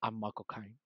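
low, hushed Cockney film-star impression; deep, quiet celebrity impersonation; Michael Caine. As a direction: drop low and soft, full Cockney character. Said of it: "I do a full Michael Caine character".

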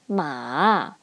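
long "ma", low dip then rise; falling-rising third tone. Shown as a demonstration. Teacher recapping third tone, "ma" dipping then rising. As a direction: sink "ma" low and bring it up. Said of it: "Third tone".

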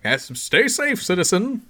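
high, stately hero sign-off; mock-noble caped crusader; superhero voice. Hero sending off cowed villains, grandly courteous.

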